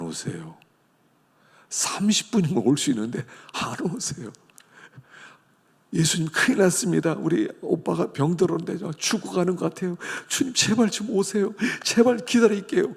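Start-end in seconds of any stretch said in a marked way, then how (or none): 5.9-12.9 high, pleading sister's cry; desperate plea. Acted. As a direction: beg urgently, voice climbing. Martha begging Jesus to come. (예수님께 오빠가 아프다고 애원하는 누이, 높고 절박하게)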